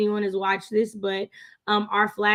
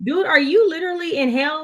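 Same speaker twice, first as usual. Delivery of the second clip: incredulous; 7 semitones higher than usual. Retold or imitated. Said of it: "I was like".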